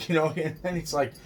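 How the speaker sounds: ordinary talk, nothing marked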